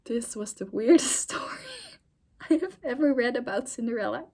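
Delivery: breaking into laughter mid-sentence; laughing. Real, not acted. Reader reacting to the weird story, laughing. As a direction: say it through a real laugh.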